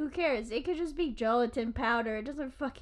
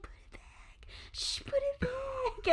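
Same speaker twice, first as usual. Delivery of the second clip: hushed, breath-only plea; urgent whisper; 13 semitones higher, 5 dB quieter, slower than usual. Retold or imitated. Buddy hushing his friend's antics, urgent whisper. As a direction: whisper it, trying not to giggle.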